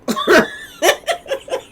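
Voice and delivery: sudden high, loud cackle of laughter; high burst of laughter. Real, not acted. Laughing at her comeback to the rival vlogger: loud high laugh. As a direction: crack up at your own comeback.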